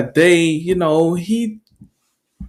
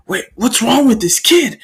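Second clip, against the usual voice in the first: raised-pitch startled question; alarmed disbelief; 8 semitones higher than usual. Acted. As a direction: ask in rising bewilderment. Startled bullies react.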